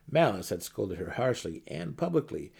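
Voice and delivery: ordinary delivery, nothing marked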